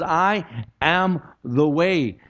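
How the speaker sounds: "I am the way" slow, with a break between words; emphatic declaration. Retold or imitated. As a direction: proclaim "I am the way" word by word. Repeats Jesus's declaration emphatically.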